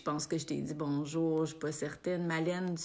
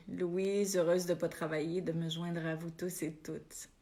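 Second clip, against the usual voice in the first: ordinary talk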